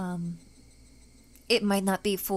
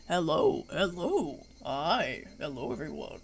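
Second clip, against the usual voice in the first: lower, dropping lower into a man's voice; guy voice; 5 semitones lower, slower than usual. Shown as a demonstration. Streamer trying a male voice for her characters. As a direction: deepen into a guy's greeting. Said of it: "I could voice the guys".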